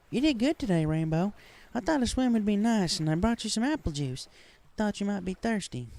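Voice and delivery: cheerful Southern pony teasing her friend; Applejack voice. A narrator's reading. Friend at swimming hole, high cheerful Southern pony voice.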